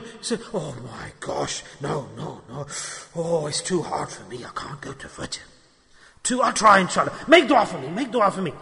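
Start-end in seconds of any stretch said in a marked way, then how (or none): 0.5-5.4 mock whining, much lower and softer; whiny excuse. Mocking a lazy worshipper who skips Fajr, whiny and soft. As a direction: sound like a lazy man moaning it is too hard.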